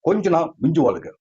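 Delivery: usual speaking voice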